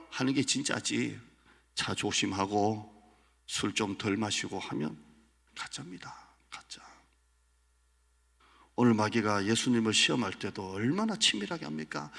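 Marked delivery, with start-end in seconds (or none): 5.5-6.8 hushed whisper; whispered aside. Real, not acted. Preacher whispering to the congregation. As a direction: whisper it like a secret.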